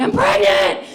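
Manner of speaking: high, loud panicked cry; screaming it through laughter at mom in the night; panicked shout. Retold or imitated. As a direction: blurt it out in terror. Waking mom, panicked announcement.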